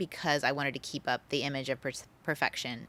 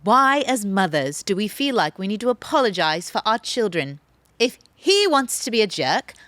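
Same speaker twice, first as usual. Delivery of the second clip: put-on loud, higher-pitched British accent; 4 semitones higher, 7 dB louder than usual. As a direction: imitate the British mentor, emphatic and loud. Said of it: "I've got my accent ready".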